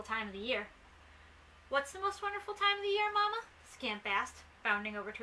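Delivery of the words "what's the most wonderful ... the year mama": high-pitched little puppy voice; curious puppy. A narrator's reading. Scamp asks his mother about the wonderful time, in a high puppy voice. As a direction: ask it as an eager child, pitched high.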